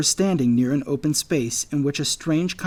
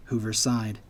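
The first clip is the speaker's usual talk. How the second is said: ordinary delivery, nothing marked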